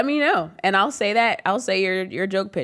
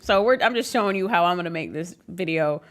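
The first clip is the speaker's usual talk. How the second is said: usual speaking voice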